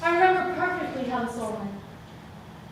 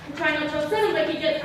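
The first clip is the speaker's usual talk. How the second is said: usual speaking voice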